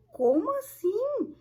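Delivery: put-on shocked interrogation; incredulous questioning. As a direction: stretch the opening in disbelief, then press the questions. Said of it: "A entonação da pessoa distorce".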